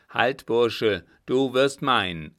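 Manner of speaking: loud command to a fleeing man; shouted challenge. A narrator's reading. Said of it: "rief der Deutsche".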